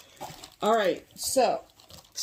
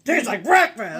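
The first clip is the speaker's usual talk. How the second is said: loud, punched mock-aggressive outburst; 2 semitones higher, 7 dB louder than usual. Joking to co-host about her own outburst, mock aggressive. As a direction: bark it out in playful aggression. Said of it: "I said that so aggressively".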